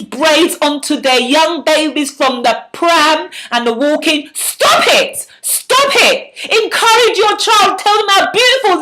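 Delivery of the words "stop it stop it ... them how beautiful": rising outrage hurled at the viewers; angry shout. Real, not acted. Vlogger scolding mothers who braid babies' hair, angry shout. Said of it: "I'm angry because I want".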